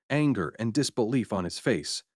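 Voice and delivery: usual speaking voice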